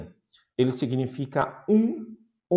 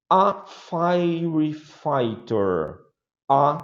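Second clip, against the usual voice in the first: slow model word; 3 semitones higher, much slower than usual. Shown as a demonstration. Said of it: "slow model pronunciation".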